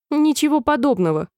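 curt contradiction. A narrator's reading. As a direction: cut her off with a firm denial. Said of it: "отрезал Твидлди".

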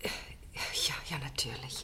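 barely voiced, trembling yes; shaken whisper. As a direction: whisper it as if still shaking from the interview. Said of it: "du zitterst ja".

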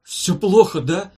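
loud, high groaned question; patient groaning for the verdict; moaning. A narrator's reading. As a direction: moan it out in despair. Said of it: "простонал директор".

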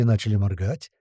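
plain reading voice

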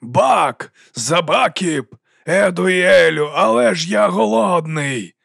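dragon-king wailing. A narrator's reading. Dragon king waking and wailing of hunger. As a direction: moan out the hunger, dragged. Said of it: "він заволав".

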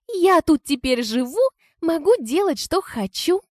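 put-on high, cocky teenage whine; bratty teen girl. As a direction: sass her like a spoiled teen. Teen Angela defying the stepmother.

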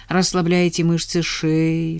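ordinary talk

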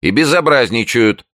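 high, loud confirmation; insistent neighbour. A narrator's reading. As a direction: insist indignantly. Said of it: "подтвердил голос". (настойчивый голос соседки)

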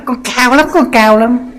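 mock her showing off, pitch way up; exaggerated vain lady boasting, high voice. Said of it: "đi tới đó".

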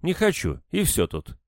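high opening, dropping to a firm close; curt refusal. A narrator's reading. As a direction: shut the topic down as a stubborn woman. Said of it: "как отрубила". (отрезать, резко и упрямо)

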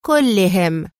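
كلهم repeated with a cold, hard fall; cruel. A narrator's reading. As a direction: hit كلهم coldly, like a verdict. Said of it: "ثم أردفت في قسوة". (كلهم بقسوة ونبرة هابطة)

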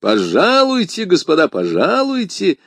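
громко и высоко; loud welcome. A narrator's reading. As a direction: loudly invite the soldiers in. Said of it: "громко произнёс Д'Артаньян".

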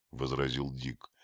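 ordinary delivery

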